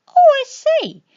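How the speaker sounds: a grand lady's delighted trill; posh lady. Acted. Lady Lobelia exclaims to the llamas, high and loud.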